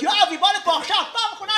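put-on calling the kid back, last warning; Israeli mom. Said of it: "כל האמהות הישראליות".

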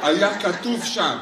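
ordinary delivery

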